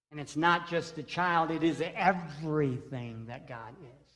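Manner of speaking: ordinary talk, nothing marked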